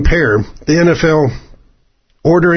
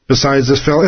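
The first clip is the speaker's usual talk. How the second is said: ordinary talk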